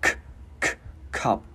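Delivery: isolated throat K bursts, then "cup"; popped K. Shown as a demonstration. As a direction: pop "k" alone, then join it into "cup". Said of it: "Chữ K mình bật cổ gắn vào". (bật cổ âm "k" rồi gắn vào "cup")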